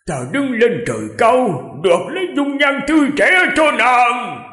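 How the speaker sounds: high-pitched, impassioned shouted vow; loud shout. A narrator's reading. King defies heaven to restore her youth, loud high shout. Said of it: "Khương Thái Hư hét lớn".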